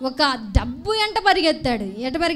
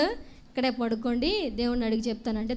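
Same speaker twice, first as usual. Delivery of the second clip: soft Balaam voice; 4 semitones lower, 8 dB quieter than usual. Retold or imitated. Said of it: "ఏమన్నాడు?".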